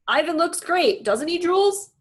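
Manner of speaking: high, loud, hearty dad; cheerful dad voice. Acted. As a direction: beam with pride. George speaking.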